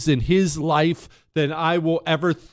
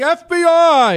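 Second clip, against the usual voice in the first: loud, much higher shout of F B I; agents shouting; 13 semitones higher, 7 dB louder than usual. Retold or imitated. FBI agents at the front door, loud high shout. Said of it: "They're shouting".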